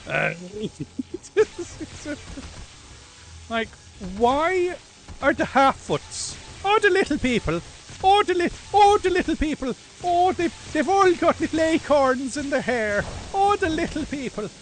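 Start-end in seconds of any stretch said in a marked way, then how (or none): 4.2-14.5 loud, high stage-Irish mockery; over-the-top little Irishman accent, shouted. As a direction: rant in an exaggerated Irish lilt, pitched right up. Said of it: "they're like the little Irish fellas".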